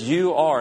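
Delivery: "You are" with weight on "You"; emphatic you are. Retold or imitated. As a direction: point a finger with "You". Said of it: "and this is emphatic".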